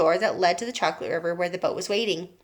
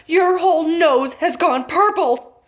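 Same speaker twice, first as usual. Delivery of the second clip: shouting father; 7 semitones higher, 6 dB louder, slower than usual. A narrator's reading. Father voiced.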